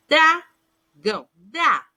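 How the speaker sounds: Dragão split slowly as "dra, gão", then dra alone; both consonants sounded. Shown as a demonstration. Teacher showing pupils a consonant cluster, slowly sounded out. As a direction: let D and R both be heard in dra. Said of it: "Eu sinto o som do D e do R".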